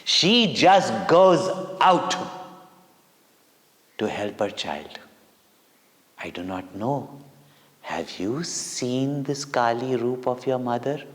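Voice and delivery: usual speaking voice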